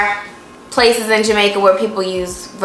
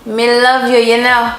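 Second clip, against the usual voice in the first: learner's Jamaican try; 2 semitones higher than usual. Shown as a demonstration. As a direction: copy the Jamaican phrase eagerly. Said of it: "when a yard man tell you".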